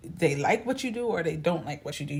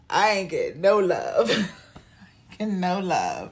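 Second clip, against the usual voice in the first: mock sulky complaint; 3 semitones higher, 5 dB louder than usual. Parents staring.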